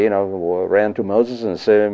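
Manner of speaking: normal talking voice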